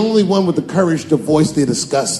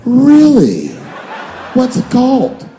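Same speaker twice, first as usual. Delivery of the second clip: high, loud delighted question; mock excited producer; 9 semitones higher, 5 dB louder, slower than usual. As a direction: squeal it out, thrilled.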